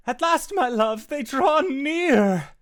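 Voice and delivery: high, booming theatrical yell. Acted. Ship's figure yelling to his love as they approach. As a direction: cry it out grandly, stretching the end. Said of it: "continuing to yell".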